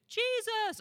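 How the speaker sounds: put-on high, loud childlike answer; little kid answering. Little boy calling out an answer from the back pew, high and loud. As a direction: pipe up like an eager child. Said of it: "And they".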